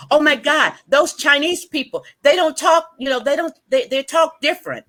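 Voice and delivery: high, astonished mock exclamation; amazed cousins. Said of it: "they were like".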